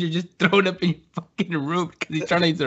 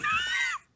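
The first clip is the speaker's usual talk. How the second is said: high laugh; 10 dB quieter than usual. Real, not acted.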